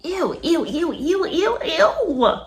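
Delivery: high squealing ew of disgust. Real, not acted. Disgusted by baby talk.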